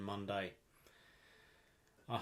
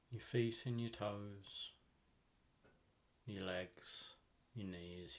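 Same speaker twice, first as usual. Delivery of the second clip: soft, unhurried meditation voice with long pauses; calm, even delivery, leaving space between phrases; guided meditation; 3 semitones lower, 10 dB quieter than usual. Acted. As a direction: guide the listener gently into deep relaxation.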